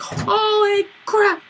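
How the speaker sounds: high, drawn-out slow cry of shock; shocked exclamation. Retold or imitated. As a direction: gasp it out in disbelief. Roommates watching him jump.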